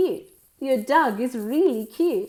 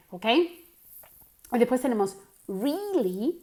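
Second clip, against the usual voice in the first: ordinary talk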